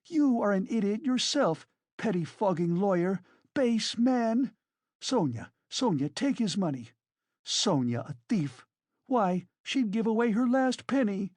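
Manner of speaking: high-pitched tirade of insults; frenzied woman's scolding; furious shrieking. A narrator's reading. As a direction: hurl the words in indignant outrage. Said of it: "shrieked Katerina Ivanovna".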